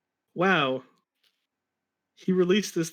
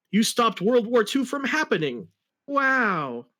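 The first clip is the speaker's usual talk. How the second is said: amazed teacher; 8 semitones higher, 3 dB louder than usual. Acted.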